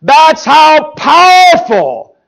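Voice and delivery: high, slow, booming proclamation; emphatic preaching shout. Real, not acted. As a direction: thunder each word out to the congregation. Preacher's climax on God's power.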